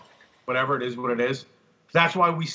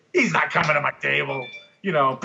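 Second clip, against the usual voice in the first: normal talking voice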